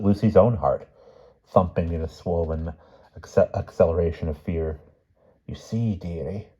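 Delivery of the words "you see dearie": put-on hushed old lady's whisper; soft, secretive whisper. A narrator's reading. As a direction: whisper it confidingly, dropping away. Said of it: "Aunt Fennel was whispering".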